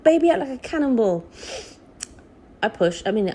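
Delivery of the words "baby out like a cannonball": excited. Retold or imitated. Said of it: "all the initial excitement".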